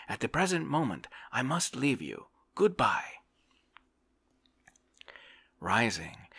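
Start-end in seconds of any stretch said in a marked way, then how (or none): 0.1-3.2 hasty farewell. A narrator's reading. Volkov hurries off, says goodbye.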